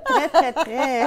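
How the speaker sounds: slow, high, loud through laughter; slow laughing words. Real, not acted. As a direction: drag it out laughing. Laughing with straw in teeth. (étirer les mots en riant)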